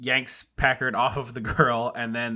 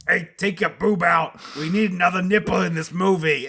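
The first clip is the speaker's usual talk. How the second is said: higher, pushy mock-director voice; crass director; 3 semitones higher than usual. Co-host parodying a director ordering nudity, crass and pushy. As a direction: bluntly demand it like a crass filmmaker.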